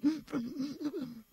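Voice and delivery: a sharp gasping intake, swallowing the cry; stifled crying gasp; held-back sob gasp. Shown as a demonstration. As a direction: gasp in as if choking back tears. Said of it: "empiezo a llorar así".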